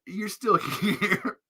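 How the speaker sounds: slow, giggling repeat; laughing. Real, not acted. Teasing viewers, slowed by laughter. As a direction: let the laugh break the phrase.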